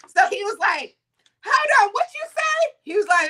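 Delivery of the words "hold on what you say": much higher, indignant challenge; acted-out demand to hear it repeated; retold confrontation. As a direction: stop him short, voice pitched up. Friend confronts the worker.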